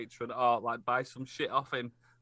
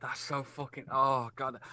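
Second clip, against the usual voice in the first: normal talking voice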